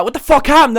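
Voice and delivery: high, loud urgent question; acted alarmed shout. Friends at the bathroom door demand answers, imitated alarm.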